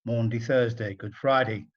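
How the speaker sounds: ordinary delivery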